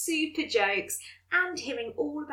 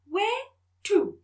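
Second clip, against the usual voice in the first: robot voice; 9 semitones higher, 6 dB louder than usual. Acted. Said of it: "said the robot hoverboard with a strangely human voice".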